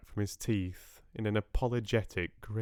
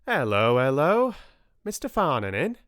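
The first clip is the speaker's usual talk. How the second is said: loud, high-pitched hail at the door; big rustic voice, calling out; hearty farmer caller; 6 semitones higher, 7 dB louder than usual. A narrator's reading. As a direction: boom out a hearty greeting. Plump farmer calls at the door.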